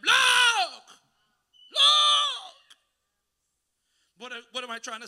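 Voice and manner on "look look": shouted look with full force; booming divine shout; yelling. Acted. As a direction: bellow it like God yelling. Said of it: "God was yelling at him".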